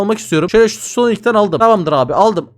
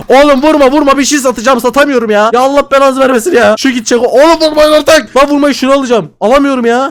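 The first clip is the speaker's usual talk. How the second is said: higher, louder panicked shouts; real frantic gamer shouting; frantic yelling; 5 semitones higher, 5 dB louder than usual. Real, not acted. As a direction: beg them to stop hitting you. Players hitting him while selling.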